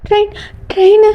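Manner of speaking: high, gasped words; breathless panic. Acted. Girl who ran to the station asking after the train, panicked. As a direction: gasp it out after running. Arrives running at the railway station.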